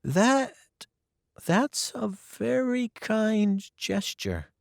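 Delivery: halting, moved by kindness; girl touched by a kind act. Acted. Anne moved, hesitant.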